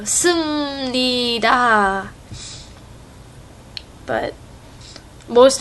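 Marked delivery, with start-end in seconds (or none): 0.0-2.1 "Sumnida" very slowly, with a closed m straight into nida; slow correct pronunciation. Shown as a demonstration. Correcting the class slowly: "Sumnida", m then nida. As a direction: spell out "Sumnida" slowly, no vowel after the m.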